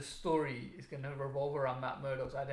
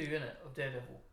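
normal talking voice

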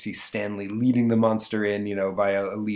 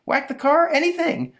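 much higher, louder frantic pleading; desperate boy; 5 dB louder than usual. Acted. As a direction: bargain frantically to escape the spanking. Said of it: "Stanley says desperately".

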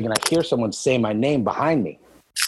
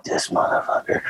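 hushed, seething mutter under the breath; whispered curse. Retold or imitated. Lifter pacing the gym, whispering a curse at his coach.

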